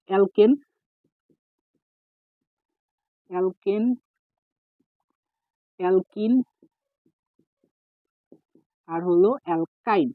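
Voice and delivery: slow dictation with long pauses between terms. Shown as a demonstration. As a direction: say each term slowly, as if writing it out. Dictating terms while writing them.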